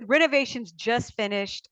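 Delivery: normal talking voice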